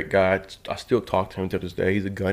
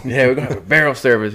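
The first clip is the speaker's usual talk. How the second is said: louder, fast barked command; barked repeat; 4 semitones higher, 5 dB louder, faster than usual. Retold or imitated. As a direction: bark it again, faster and louder. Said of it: "He's like".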